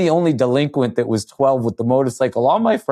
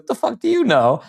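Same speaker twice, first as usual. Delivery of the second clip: much higher, sneering comeback; mocking retort; 10 semitones higher than usual. Older kid scoffs at young Todd, high and sneering. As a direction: scoff at the kid who thinks he knows weed.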